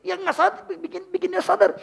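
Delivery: high, laughing through the words. Real, not acted. Preacher laughing at the pinching helpers, high. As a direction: chuckle it out.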